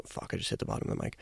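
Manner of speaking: quick whispered curse under breath; whispered aside. Real, not acted. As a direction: mutter it fast in a whisper. Mic bump, quick aside.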